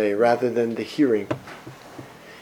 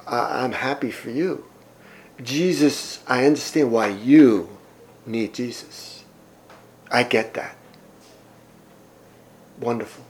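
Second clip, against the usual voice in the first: softer, patronizing mock of a polite skeptic; mock condescension; 3 semitones lower, 4 dB quieter than usual. Mimicking people who say they don't need a savior, patronizing and soft. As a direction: brush it off sweetly, barely audible. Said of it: "people saying things like".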